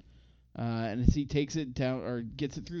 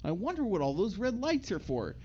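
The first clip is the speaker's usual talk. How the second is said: naive Deuce; 9 semitones higher than usual. Retold or imitated. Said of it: "walking by the red light district and goes".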